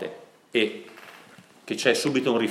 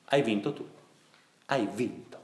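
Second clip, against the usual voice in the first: quiet tearful words; 7 dB quieter than usual. Retold or imitated. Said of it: "il figlio dice che piangeva".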